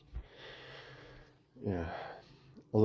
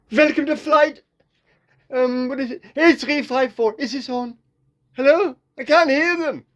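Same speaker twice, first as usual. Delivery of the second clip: nervous rookie pilot; 9 dB louder than usual. Acted. Nervous new pilot on intercom.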